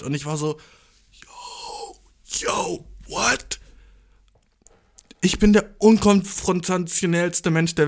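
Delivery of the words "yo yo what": voiceless, disbelieving gasp of words; hushed, hammed-up disbelief; stunned whisper. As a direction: whisper it in mock shock. Acts stunned reaction to her reply.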